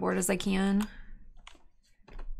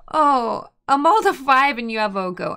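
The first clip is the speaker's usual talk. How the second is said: loud outrage; 2 semitones higher, 9 dB louder than usual. Real, not acted. Outraged at opponent's Oko.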